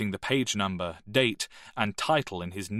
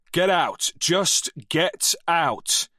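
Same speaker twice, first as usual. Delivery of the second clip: a loud, higher shout, slowing on the second; furious shout; 8 semitones higher, 5 dB louder, much slower than usual. Acted. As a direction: throw them out in a rage.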